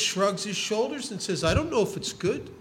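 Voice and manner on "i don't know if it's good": put-on light Yiddish accent, higher and softer; a hint of a Yiddish old man. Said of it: "He has a little bit of a Yiddish accent".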